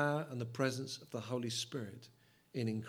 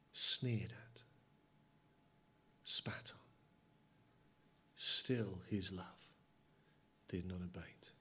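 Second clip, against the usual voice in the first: hushed whisper; 6 semitones lower, 5 dB quieter, slower than usual. Acted. Suffering of Jesus, hushed.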